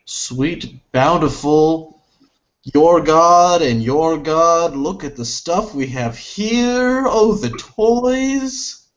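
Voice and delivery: rising, slow, awestruck glee; gleeful delight. Acted. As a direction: savor every word like a kid with new toys. Character marvels at captured guns.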